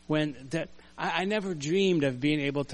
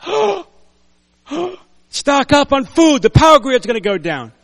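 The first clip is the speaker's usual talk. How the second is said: hammed-up gasping panic, much higher and louder; mock panic; 10 semitones higher, 13 dB louder than usual. Preacher mocking panicked Americans, hammed-up gasping alarm. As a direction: gasp and squeal like a frightened hoarder. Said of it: "some Americans are like".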